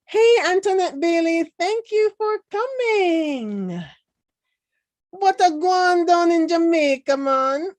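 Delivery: a loud, high Jamaican greeting; Jamaican accent. Pretend. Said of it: "this is a Jamaican doing a Jamaican accent".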